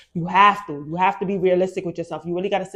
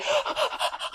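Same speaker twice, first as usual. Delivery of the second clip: sudden high gasp; sharp gasp; 5 dB quieter than usual. Retold or imitated. Mock gasp at her own impulsive urge. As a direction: gasp as if struck by an idea.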